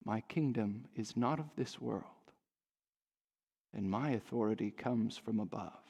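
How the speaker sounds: very soft, low, measured answer; quiet calm. A narrator's reading. Jesus answering the unsettled Pilate, quiet calm. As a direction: answer gently, with hushed assurance.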